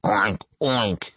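imitated pig oinks; pig's grunt. A narrator's reading. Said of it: "grunted the pig".

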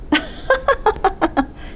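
a bright burst of laughter, high up; sudden high laugh. Acted. Seibel suddenly understands and bursts out laughing. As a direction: laugh out as the penny drops. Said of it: "他笑起来".

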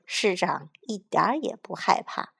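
plain reading voice

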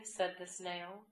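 ordinary delivery

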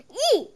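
high loud frightened squeal; startled squeal. A narrator's reading. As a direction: shriek as if startled. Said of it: "yelled Hal".

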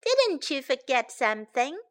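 ordinary delivery, nothing marked

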